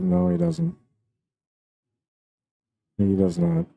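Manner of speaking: sudden high-pitched surprise; surprised. Real, not acted. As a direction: blurt it out, caught off guard. Shot missed during live commentary.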